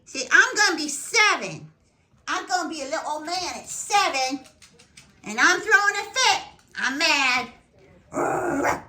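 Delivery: high, loud grumpy pug voice; be the pug throwing a fit; voicing the dog. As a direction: owner playfully voicing her sulking pug. Speaking as the birthday pug.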